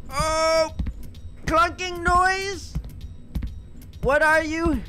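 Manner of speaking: startled high frightened cries; scared. Real, not acted. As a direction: jump at the sound, scared. Startled by noise.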